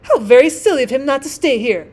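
loud, scornful self-importance; pompous rocket. A narrator's reading. Rocket left by the dragonfly, loudly disdainful. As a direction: sniff at him grandly.

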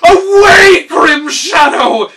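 loud, high, panicked shout; terrified cry. A narrator's reading. Gnome king shrieking at the robot he thinks is a ghost. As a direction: scream at the ghost to go away. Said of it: "he cried".

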